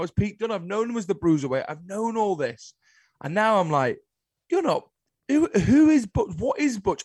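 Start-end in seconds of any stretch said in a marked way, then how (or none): 4.5-7.0 high-pitched, exasperated bewilderment; baffled disbelief. Acted. Fan reacting to the renamed wrestler, baffled. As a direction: act out being baffled, pitch leaping up.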